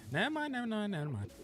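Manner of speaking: playful falsetto retraction; sing-song high take-back; squeaky high voice. Pretend. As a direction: jump up high and squeak the never minds. Repeats it in a squeaky voice.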